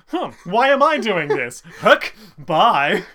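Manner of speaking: high, loud, flippant throwaway; goofy random. Shown as a demonstration. Showing the line out of context, high, loud and flippant. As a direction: toss it off like a silly prank. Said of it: "removed from that context".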